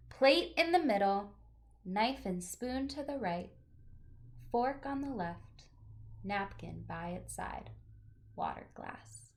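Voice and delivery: gentle, expressive picture-book reading; reading to children. Acted. Teacher reading a picture book aloud to her class, storytelling voice.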